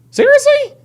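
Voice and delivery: can't believe what he's reading; exasperated disbelief. Real, not acted. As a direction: streamer stunned by a troll's message. Exasperated at troll chat.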